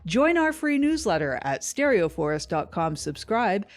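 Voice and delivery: loud, bright promo voice; ad read. Acted. Network ad read inviting listeners to subscribe. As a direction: sell the newsletter like a radio spot.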